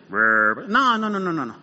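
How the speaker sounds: very low mock demon growl; voz de demonio. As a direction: imitate a monster's deep rumble. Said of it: "no era una voz así de". (imitando un gruñido demoníaco, muy grave)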